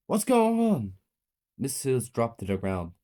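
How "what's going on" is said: high, loud panicked question. A narrator's reading. Child seeing the teacher collapse, panicked.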